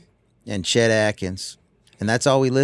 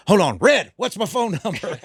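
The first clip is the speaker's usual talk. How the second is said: much higher, comic call to an aide; 9 semitones higher than usual. Retold or imitated. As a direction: act out a star hollering for help, then asking. Acts out [name] calling an aide.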